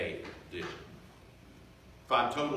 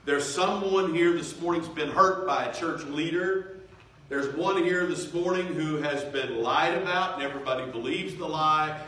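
raised, insistent pulpit voice; impassioned preaching; 3 semitones higher, 4 dB louder than usual. Real, not acted. Preacher naming the hurts of his listeners, fervently. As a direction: drive each case home to the congregation.

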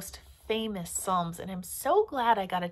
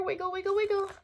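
much higher, bouncy wiggle call; playful squeaky wiggle; 10 semitones higher, much faster than usual. Acted. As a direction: squeak it out to make kids giggle. Kids told to wiggle fingers.

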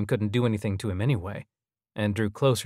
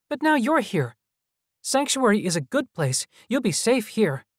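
put-on very high, hopeful little-boy voice; brightened child; 12 semitones higher, 3 dB louder than usual. A narrator's reading. Child hologram welcoming visitors to safety, high and brightened. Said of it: "its face brightened".

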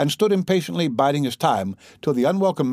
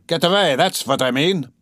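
louder, high angry retort; 4 semitones higher, 3 dB louder than usual. Acted. Raskolnikov driving off the dandy, angry. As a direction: snap it back at him.

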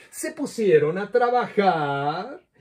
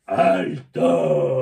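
much lower, booming monster "¡Alto!"; deep monster voice; 13 semitones lower than usual. Acted. Cat-crocodile monster halting the children, deep and booming. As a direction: drop way down into a big beast's growl.

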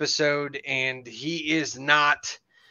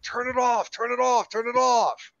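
high-pitched, insistent pleading; frantic retold demand; 9 semitones higher than usual. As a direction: imitate a driver desperate to stop the music. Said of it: "Jan's going like".

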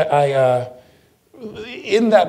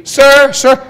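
much higher, loud woman calling sir; 15 dB louder than usual. Retold or imitated. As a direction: call out to him again, urgent.

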